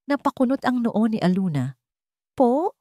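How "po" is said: confused. A narrator's reading. Aluna's confused question.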